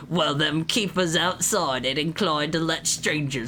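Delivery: put-on British game character; British accent. Challenging an intruder with a poker, mock British accent. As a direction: play it as a rough-spoken British bug. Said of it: "This is my British voice".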